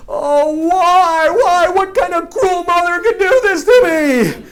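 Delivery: high, loud, hammed-up wail; mock crying. Boy grieving over his toy car, mock tearful wail. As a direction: wail like a heartbroken kid, overdone. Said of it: "I was crying".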